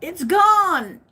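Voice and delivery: high, loud disbelieving rush; excited disbelief. Real, not acted. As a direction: insist it's true, amazed. Incredulous about vanished tree.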